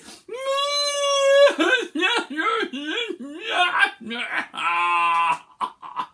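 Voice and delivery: laughing wail; mock sob with laughter. Shown as a demonstration. Teacher cracking up mid sad-noise demo, laughing wail. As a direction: cry and crack up. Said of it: "Join in, please".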